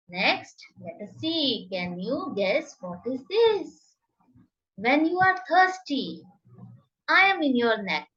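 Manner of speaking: usual speaking voice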